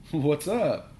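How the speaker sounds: high, breezy question; cheerful. A narrator's reading. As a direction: toss it out cheerily. Dad cheerful, kids silent.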